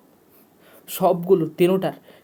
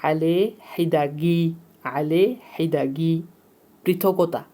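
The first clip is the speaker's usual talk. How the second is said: slow, syllable by syllable dictation; slow repetition; slower than usual. Shown as a demonstration. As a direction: spell out আলে হিদাগি slowly for students. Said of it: "আবার বলতেছি".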